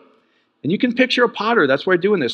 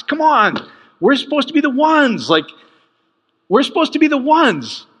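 high-pitched, worked-up plea; exasperated appeal; 7 semitones higher than usual. Retold or imitated. Preacher appeals to fellow Christians, exasperated. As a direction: plead with people who should know better.

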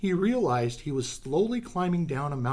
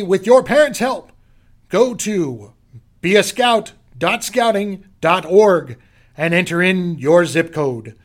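loud, bright promo announcer; punchy dramatic comic narration; 5 semitones higher, 10 dB louder than usual. Acted. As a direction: sell it big to the kids. Loud promo sign-off read.

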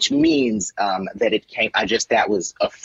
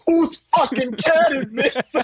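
slow, very high mock outrage; hammed-up high-pitched viral-video imitation; Michael Rappaport; slower than usual. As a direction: draw out the incredulous question. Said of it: "and it goes".

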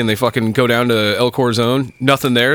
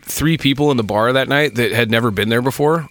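usual speaking voice

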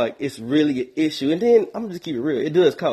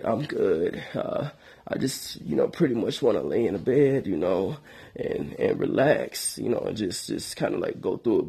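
low, soft, weary sick man; feeble sick voice; 3 semitones lower, 4 dB quieter than usual. Pretend. Putting off the worried friend in a soft, low sick voice. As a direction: brush her off sounding weak. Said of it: "I answer with my sick voice".

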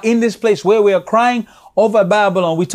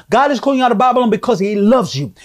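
usual speaking voice

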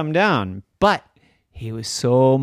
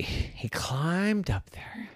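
whisper; 5 semitones higher, 9 dB quieter, much slower than usual. A narrator's reading. Storyteller hushes.

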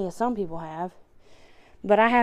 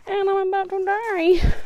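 laughing; 11 semitones higher than usual. Real, not acted. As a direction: laugh it out, exaggerating. Laughing, out of breath.

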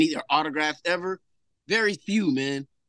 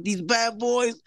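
higher, playful movie quote; quoting movie line; 8 semitones higher, much slower than usual. Retold or imitated. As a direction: cue her memory with the line. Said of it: "I was like".

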